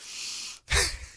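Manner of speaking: airless squeaky burst of laughter; a short stifled giggle; breathless high laugh. Real, not acted. Laughs at dark story.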